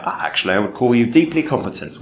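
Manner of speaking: imitating a reserved British director. British director grudgingly praising the actor, understated British accent. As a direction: put on measured British praise. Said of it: "effusive praise from a Brit".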